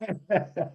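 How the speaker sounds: a short low chuckle; brief amused laugh; real laugh. Real, not acted. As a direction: laugh softly before speaking. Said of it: "I'm laughing because".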